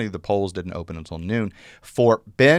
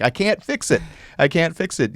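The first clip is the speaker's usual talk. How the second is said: higher exasperated retold protest; 8 semitones higher than usual. Judge telling the voter his ripped ballot is lost, exasperated. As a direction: throw up your hands, half-mocking.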